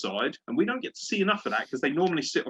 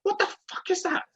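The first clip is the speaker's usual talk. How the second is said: retold scream at the TV. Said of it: "Screaming at it".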